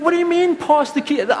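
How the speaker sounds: high, loud outraged squeak; indignant disbelief. Retold or imitated. To his wife chopping carrots, high and outraged. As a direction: shoot up in pitch, stunned. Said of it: "I'm like".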